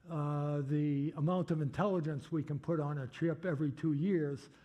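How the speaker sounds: normal talking voice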